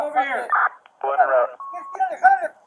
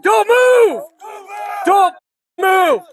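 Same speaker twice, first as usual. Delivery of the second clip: shouted police commands; 5 semitones higher than usual. Real, not acted. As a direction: yell it straight at the suspect. Officer yelling at suspect after shots.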